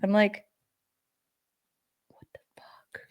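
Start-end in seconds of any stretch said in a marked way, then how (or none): 2.1-3.0 a hushed disbelieving whisper; whispered curse. Retold or imitated. The woman retelling her reaction to not being carded, a whispered curse. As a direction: whisper it, no voice at all.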